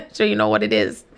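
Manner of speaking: low chuckling aside; low laughing remark. Real, not acted. Laughs after holding back rudeness.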